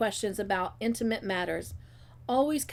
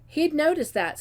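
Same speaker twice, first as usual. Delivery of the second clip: higher-pitched, indignant retort; disdainful sniff; 7 semitones higher, 4 dB louder than usual. A narrator's reading. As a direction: sniff it out with mild scorn. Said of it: "sniffed Miss Cornelia".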